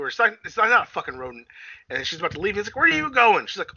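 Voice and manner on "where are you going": calling after. Retold or imitated. Guy calls after her.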